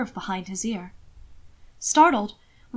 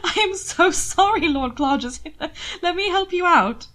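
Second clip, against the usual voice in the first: burbling laughter; 8 semitones higher than usual. A narrator's reading. Will apologizing to Hannibal in the water, helpless laughter. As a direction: giggle through the words. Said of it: "saying with burbling laughter".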